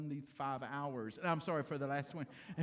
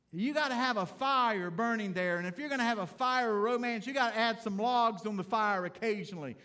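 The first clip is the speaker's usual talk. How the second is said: louder, driving sermon delivery; preaching at full force; 3 semitones higher, 6 dB louder than usual. Acted. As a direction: preach it out to the men in the pews. Preaching to congregation.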